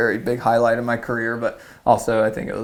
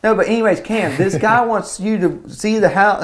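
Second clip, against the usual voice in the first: oblivious dad imitation; 8 semitones higher, 4 dB louder than usual. Dad to son pressing on about the house, oblivious.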